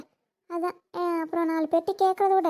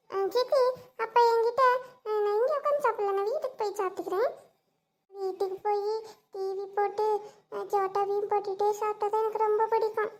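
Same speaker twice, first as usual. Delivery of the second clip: coaxing little niece; 2 semitones higher than usual. Acted. Plays the little niece asking.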